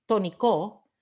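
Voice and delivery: "tónico" misstressed as toniCÓ, last syllable punched; wrong final-syllable stress. Shown as a demonstration. Teacher showing what we don't say, "tónico" stressed on final CO. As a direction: put the weight on the final O of "tónico". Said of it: "We don't say tónico".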